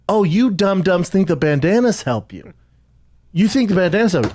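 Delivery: high-pitched scornful taunt; mocking. Cyclist to bandana-wearing couple, retold mockery. Mocking riders in acted quarrel.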